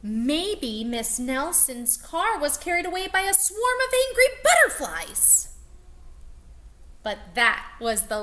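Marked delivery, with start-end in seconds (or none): 0.0-5.5 rising high and loud at the end; know-it-all exclaiming. Acted. Kid blurting a wild guess to the class, exclaiming. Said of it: "exclaimed one know-it-all".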